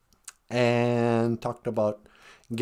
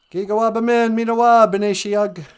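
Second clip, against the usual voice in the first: cheery goodbye; 8 semitones higher, 5 dB louder than usual. Real, not acted. Farewell to the birds.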